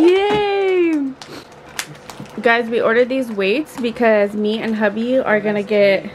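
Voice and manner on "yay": cheering. Real, not acted. Cheering with the child.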